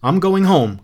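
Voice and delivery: quavering. A narrator's reading. Frightened guest announcing her exit, quavering. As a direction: voice quavering with nerves. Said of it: "quavered Missus Bowles".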